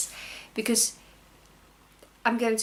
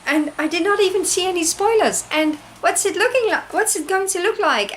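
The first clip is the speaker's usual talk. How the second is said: excited; 8 semitones higher, 5 dB louder than usual. Real, not acted. Excited retelling of the parcel arrival.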